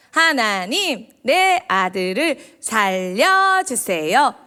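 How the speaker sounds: slow, drawn-out polite plea to God; measured prayer, every word stretched out; composed mock prayer. Teacher showing children a too-composed mother's prayer, slow and measured. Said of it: "이런 기도일까요?".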